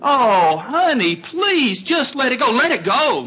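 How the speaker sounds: high pleading wife, lightly mocked; wife's voice. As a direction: play the worried wife calming her husband.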